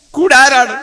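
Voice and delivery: high, insistent repeated question; urgent question. Acted. Abu Bakr asks the Prophet who will accompany him, urgently.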